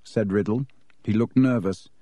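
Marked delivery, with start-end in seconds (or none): none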